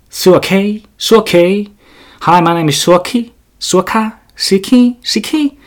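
high, squeaky fake English introduction; mock English name. Mocking himself introducing himself in English, high and squeaky. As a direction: twist your own name into silly English. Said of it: "Sellaistähän se on".

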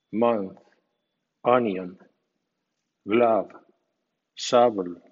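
each English word clear and apart, with a pause after it; reading an English word list. Shown as a demonstration. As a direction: model each word for the learner, one by one. (lista de palabras en inglés, clara y pausada)

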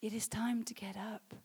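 subdued, earnest preaching; hushed exhortation. Real, not acted. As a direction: say it quietly, letting it sink in. Same exhortation repeated softly after shouting.

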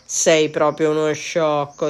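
loud, drawn-out, slow jeer; triumphant exclamation. A narrator's reading. Cat to the ogre turned mouse, exclaiming. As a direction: exclaim it slowly in a put-on voice. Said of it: "esclamò il gatto".

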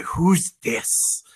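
hushed imitated crowd whisper. Onlookers wondering about the new guy, whispering. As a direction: breathe it like curious onlookers.

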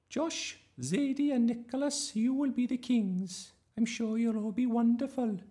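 high, loud sing-out teacher voice; fussy drama teacher. A narrator's reading. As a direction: call the class to order, high-pitched. Voices teacher Miss Darling.

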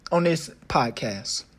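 ordinary delivery

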